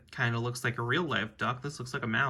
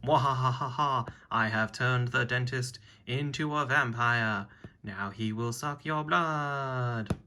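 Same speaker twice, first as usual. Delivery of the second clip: slow theatrical villain menace; slower than usual. Acted. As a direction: gloat slowly like a cartoon villain. Villain reveals the vampire plan.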